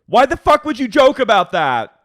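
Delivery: very high, loud shriek of rage; imitating furious Snooki. Snooki screaming at Emilio over the phone, enraged. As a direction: scream it, shaking with fury. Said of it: "filling with rage and she's shaking".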